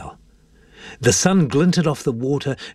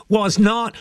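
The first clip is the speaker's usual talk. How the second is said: put-on high boyish retort; high, peeved kid voice; sulky denial; 13 semitones higher than usual. A narrator's reading. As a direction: deny it like a sore loser. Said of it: "Jack went into a sulk".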